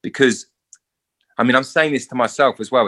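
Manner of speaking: normal talking voice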